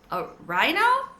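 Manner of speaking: high squeaky hamster question; squeaked. A narrator's reading. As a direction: squeak it out in surprise. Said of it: "I squeaked".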